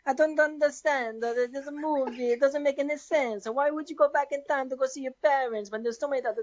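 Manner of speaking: hammed-up Italian accent, sing-song indignation; comic Italian-accented rant. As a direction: argue like a baffled Italian. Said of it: "[name], in Italian, you can go first".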